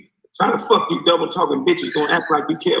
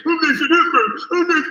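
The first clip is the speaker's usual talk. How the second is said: high, laughing mock of a gossiping man; mocking effeminate talk. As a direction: lampoon men who talk like that, pitched high. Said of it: "you men talk so effeminate".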